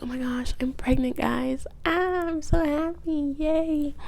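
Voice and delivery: high, drawn-out mock squeal; hammed-up happy announcement, stretched at the end; fake gushing joy. Wife announcing her pregnancy on the news, fake gushing.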